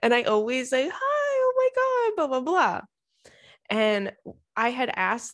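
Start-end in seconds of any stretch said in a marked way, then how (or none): 0.9-2.8 put-on high, slow baby talk to a cat; cooing at cat. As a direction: coo at the little cat.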